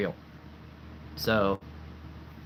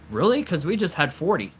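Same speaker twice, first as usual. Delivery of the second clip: surprised; 6 semitones higher, 3 dB louder than usual. Retold or imitated. Neighbor's surprised reply, retold.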